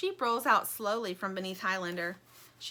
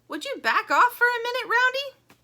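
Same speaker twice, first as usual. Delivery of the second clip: very high, loud peevish voice; annoyed shark; 10 dB louder than usual. Acted. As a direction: brush her off irritably.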